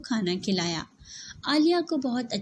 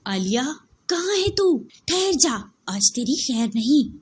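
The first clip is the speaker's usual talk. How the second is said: furious, louder calling and threatening; angry shouting; 3 semitones higher, 4 dB louder than usual. Acted. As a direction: yell for the girl in a rage. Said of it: "गुस्से से भरी आवाज". (गुस्से में चिल्लाती जेठानी)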